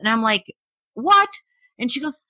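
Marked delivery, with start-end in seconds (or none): 0.9-1.3 high, sharp shocked question; shocked what. Retold or imitated. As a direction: blurt it out in disbelief. Shocked at grandma's story.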